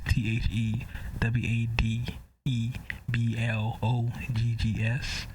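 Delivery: slow letter-by-letter spelling; spelled out slowly. Shown as a demonstration. Host spelling his name for listeners, slow and deliberate. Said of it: "Always remember".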